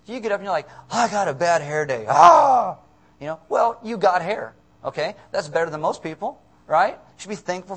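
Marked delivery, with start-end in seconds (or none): 0.9-2.1 loud, overblown complaint; hammed-up morning moan; mock whining. Acts whining over bad hair. 2.1-2.8 high, loud "ah" wail; exaggerated cry of horror; mock scream. Acts despair at bad hair.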